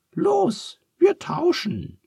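very high falsetto order; Charles's squeaky command. A narrator's reading. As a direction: squeak it out briskly.

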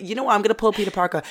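normal talking voice

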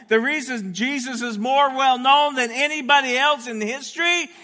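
high, loud preaching building to a shout; full-force preaching. Real, not acted. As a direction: drive it up to a shouted proclamation.

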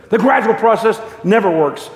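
high, forceful rebuttal; acted angel's firm pronouncement; firm contradiction. Angel firmly contradicts the ghost.

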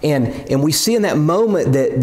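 ordinary talk, nothing marked

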